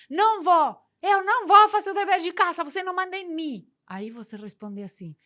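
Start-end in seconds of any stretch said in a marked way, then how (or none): 0.1-3.7 put-on loud, high, defiant kid shouting back; child refusing homework, shouting at the parent, defiant tantrum.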